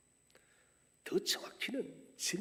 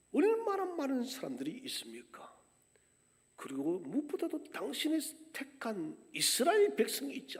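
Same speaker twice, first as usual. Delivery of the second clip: higher, louder outraged protest building to a shout; crying out to God; 4 semitones higher, 10 dB louder than usual. Retold or imitated. Israelites protesting God's choice of Cyrus, rising to a shout. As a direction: refuse to accept it, shouting it out. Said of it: "이 외침은".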